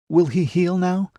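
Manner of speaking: high, anxious plea. A narrator's reading. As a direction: ask in a worried, pleading voice. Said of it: "Matilda said anxiously".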